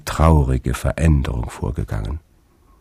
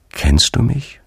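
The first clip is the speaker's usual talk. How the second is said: regal purring riddling voice; 9 semitones higher than usual. Acted. Sphinx questions Fabel.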